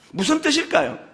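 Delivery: usual speaking voice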